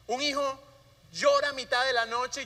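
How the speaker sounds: ordinary talk, nothing marked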